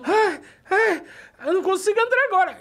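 high, loud mock wail; distressed actress. Actress to colleague before her entrance, distressed. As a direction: imitate a woman too overcome to go on. Actress voiced, unable to go onstage.

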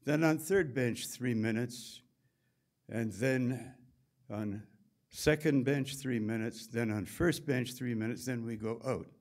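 put-on a mild Swiss accent, counting off the sauna routine. Swiss technician explaining sauna rules, in a light Swiss accent. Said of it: "fit my Swiss accent".

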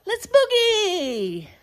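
high, slow drawn-out call; big finale call. Acted. Host calling the children to boogie, big finale. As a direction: sing it out as the finale.

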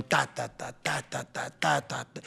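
"ta" in groups, the first of each accented; vocal rhythm demo. Shown as a demonstration. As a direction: tap out a steady "TA-ta-ta" pattern with the voice. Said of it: "una esencia musical".

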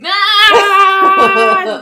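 long, high, loud "aah"; drawn-out cry of mock agony; frustrated yell. Real, not acted. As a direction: let out a long loud wail. Loud reaction after losing the joke.

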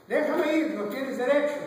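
put-on defiant boy. Boy demanding teacher let go, loud defiance. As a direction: shout back at the teacher.

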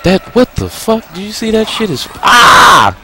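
loud, very high panicked squeal; alarmed yelling. Real, not acted. Gamer to the boss attacking him, panicked high yelling. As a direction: yell in alarm, breaking into a scream. Game attack turns frightening.

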